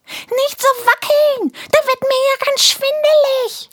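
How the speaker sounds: tiny creature getting shaken about; dizzy squeaky creature. Acted. Pupsi complains from the backpack. (sehr hohe, jammernde Piepsstimme)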